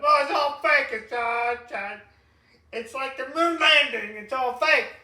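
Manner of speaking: hammed-up high, loud protest; mock denier yell. Mimicking deniers of the Biden videos, hammed-up shouting.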